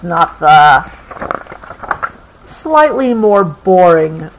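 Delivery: ordinary delivery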